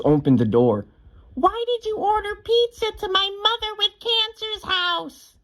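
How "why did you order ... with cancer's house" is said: very high-pitched mock shriek, no louder than talk; imitated scream. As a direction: mimic an outraged shriek in a piercingly high voice. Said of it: "scream as loud as she can".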